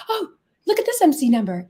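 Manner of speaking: imitated customer. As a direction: lightly mimic a keen onlooker. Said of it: "when people are like".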